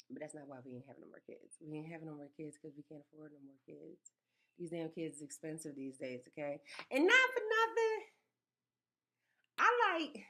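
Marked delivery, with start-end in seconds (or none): 0.1-4.1 quieter aside. Real, not acted. Aside confiding real reason. 6.9-10.3 high exclamation. Real, not acted. Mom telling listeners her point, high and loud exclamation.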